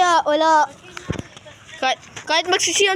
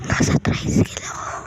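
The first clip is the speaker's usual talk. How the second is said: hushed unvoiced whisper. Real, not acted. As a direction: whisper so nobody hears. Hiding, whispering location.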